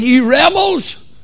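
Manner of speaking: high, loud, drawn-out preacher's shout; shouted name-calling. Acted. Moses calling the Israelites rebels, shouted slow and high. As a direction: holler it slowly at the whole crowd. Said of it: "He called them rebels".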